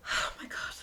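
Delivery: unvoiced, exasperated whisper to oneself; mock overwhelmed aside under the breath. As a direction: breathe it out, worn down. Acted overwhelmed reaction to chatterer.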